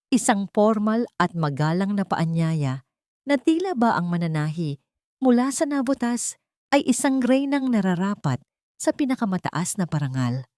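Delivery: ordinary delivery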